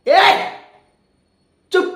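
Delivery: loud furious snap; angry shout. Acted. Villain silencing crying woman, furious shout. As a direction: bark her into silence.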